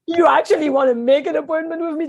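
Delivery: can't believe anyone called; incredulous thought. Retold or imitated. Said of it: "That's what I felt in my head".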